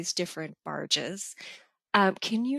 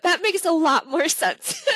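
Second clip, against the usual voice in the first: laughing; 9 semitones higher, 4 dB louder than usual. Real, not acted. Laughing at own mishearing.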